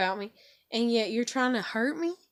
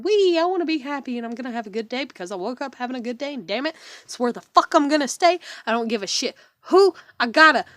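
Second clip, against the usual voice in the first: louder, fast, defiant cheerful voice; forced cheer; 2 semitones higher, 8 dB louder than usual. Pretend. Her emotional self, insisting on a good day, defiantly cheerful. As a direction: push through with stubborn happiness. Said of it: "stay in my emotional self".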